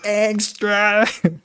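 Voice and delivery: high nasal yell of the name, imitating; hammed-up nasal cry of Angstrom; nasal shouted name. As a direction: shout the name through the nose, mock. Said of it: "He went like".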